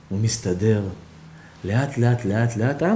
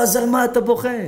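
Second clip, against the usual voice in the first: insistent doctor; 10 semitones higher than usual. Acted. Doctor insisting the old man explain his crying, high. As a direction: demand the reason.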